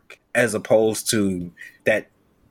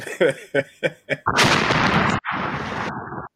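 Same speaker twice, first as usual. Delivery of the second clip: long, breathy cracking-up laugh; real laugh; 4 semitones higher than usual. Real, not acted. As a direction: crack up and let it run out. Cracking up at co-host's score.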